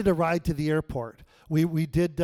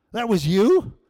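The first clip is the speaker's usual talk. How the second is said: high, loud rising question; can't believe it; shocked, loud and high; indignant surprise; 5 semitones higher, 8 dB louder, slower than usual. Retold or imitated. Shocked reply to God.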